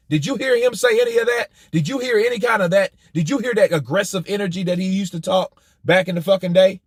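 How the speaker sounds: ordinary talk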